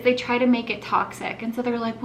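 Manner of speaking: usual speaking voice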